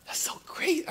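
high hushed whisper of delight; awed whisper. Real, not acted. Preacher recalling Texas ice, awed whisper. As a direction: breathe it out in wonder.

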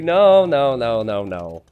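ordinary talk, nothing marked

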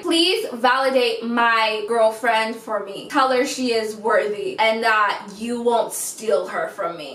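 slow, measured imitation of begging; mock-solemn drawn-out plea; mock pleading. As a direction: spell out his plea slowly, word by word. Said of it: "that's what it was giving".

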